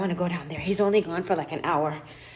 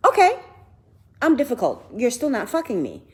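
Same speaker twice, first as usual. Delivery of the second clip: loud, high-pitched mock concession; sassy comeback, starting high and loud; defiant retort; 6 semitones higher, 5 dB louder than usual. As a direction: throw it back at him, high and defiant. Acted retort to being called difficult.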